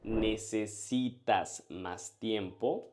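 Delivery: Necesitas más tiempo said slowly for the learner to repeat; unhurried, every syllable clear; slow clear demo. Shown as a demonstration. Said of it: "So how would you ask".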